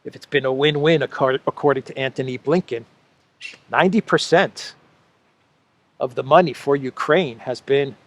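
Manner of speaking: normal talking voice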